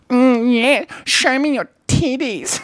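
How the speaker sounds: high, drawn-out mock lust; silly seduction voice. Shown as a demonstration. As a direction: squeal a goofy come-on. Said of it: "And I'm still like".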